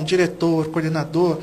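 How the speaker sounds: ordinary talk, nothing marked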